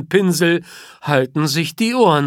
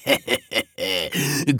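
soft gloating chuckle; 4 semitones lower, 6 dB quieter than usual. A narrator's reading. Lion gloats.

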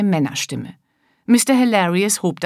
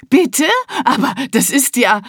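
shocked disbelief; 6 semitones higher, 3 dB louder than usual. Acted. Shocking news by phone.

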